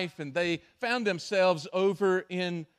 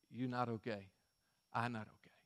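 grim captain; 7 semitones lower, 11 dB quieter, faster than usual. Retold or imitated. Captain's broken English, low quiet.

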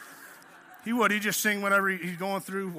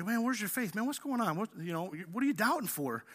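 soft, understated scolding; mild rebuke; 7 dB quieter than usual. Retold or imitated. Preacher voicing Jesus counselling Peter, mild soft rebuke. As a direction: gently take him to task, keeping it quiet. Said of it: "he kinda rebukes him a little".

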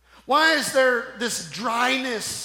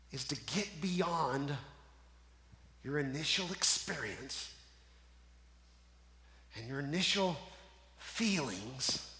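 soft, near-whispered sermon, much softer; hushed preaching; 6 semitones lower, 11 dB quieter than usual. Acted. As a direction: draw the congregation in with a hush. Hushed intimate preaching passage.

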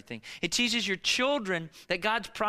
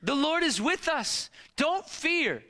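bold rallying cry; 7 semitones higher than usual. Retold or imitated. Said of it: "What they say".

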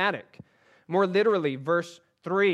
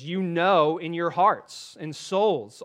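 slow, louder, leaning on know in "you know in your hearts and souls"; slow savoring reread; 4 dB louder, slower than usual. Shown as a demonstration. Preacher rereads a favorite verse to the congregation, slow and savoring. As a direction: linger over each word of the phrase. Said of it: "I like that phrase".

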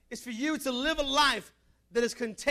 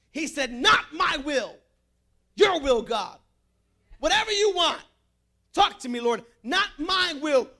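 full-force preaching; 5 dB louder than usual. Acted. Pastor fires up the congregation, preaching at full force. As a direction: preach it hard and let it ring.

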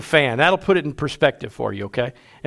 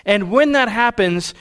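raised preaching voice; 5 semitones higher than usual. Acted. Pastor exhorting the congregation, raised. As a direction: drive the point home from the pulpit.

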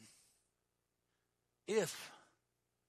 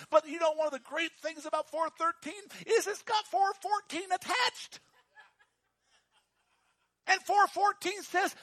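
full-force preaching; 9 semitones higher, 7 dB louder than usual. Acted. Pastor urging the congregation to believe, loud preaching. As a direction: preach to them, building to a shout.

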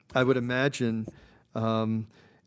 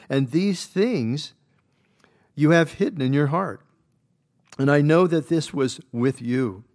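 scripture read at full force; 8 dB louder than usual. Acted. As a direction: proclaim each line like a pulpit reading. Reads Job's words aloud.

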